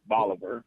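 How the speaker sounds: Bolivar as BAH-luh-ver; rhymes with Oliver. Shown as a demonstration. Caller demonstrates for the host, Bolivar rhyming with Oliver. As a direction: say Bolivar with an A-like first vowel. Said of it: "Rhymes with Oliver".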